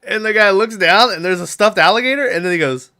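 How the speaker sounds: ordinary talk, nothing marked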